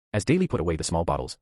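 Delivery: ordinary delivery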